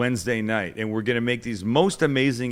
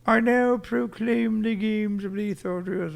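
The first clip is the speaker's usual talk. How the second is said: the Queen; 10 semitones higher than usual. Retold or imitated. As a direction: proclaim it grandly like a monarch. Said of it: "She was like this".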